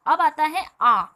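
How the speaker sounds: आ called out loud; vowel intro. Shown as a demonstration. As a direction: announce the vowel आ clearly. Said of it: "आ की मात्रा".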